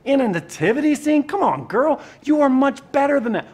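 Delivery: high, loud, incredulous parental scolding; loud mock-parent telling-off. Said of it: "You know, parents do that".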